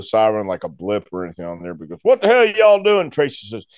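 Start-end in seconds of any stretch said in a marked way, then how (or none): 2.0-3.1 a louder lawman's "What the hell y'all doing?"; stern cop. Retold or imitated. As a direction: demand it like a cop who found a brawl. Police officer arrives.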